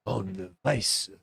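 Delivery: low, slow late-night radio host voice; Fernando impression. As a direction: croon it like a smooth radio DJ. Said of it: "You guys know Fernando".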